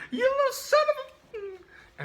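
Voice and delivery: very high, loud furious scream cut off; enraged shriek. Retold or imitated. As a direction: screech out a curse in fury. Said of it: "he was like".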